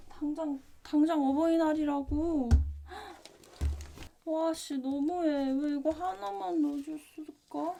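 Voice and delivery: panicked and flustered; rattled, voice up high. Real, not acted. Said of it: "나 정말 당황스러워".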